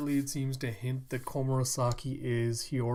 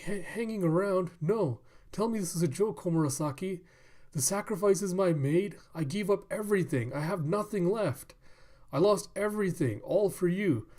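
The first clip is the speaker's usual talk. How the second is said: high, distraught acted pleading; desperate plea; 6 semitones higher than usual. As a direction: plead like a heartbroken suitor in disbelief. Jilted old man begging courtesan.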